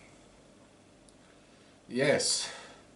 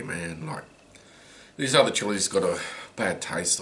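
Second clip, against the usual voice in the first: ordinary talk, nothing marked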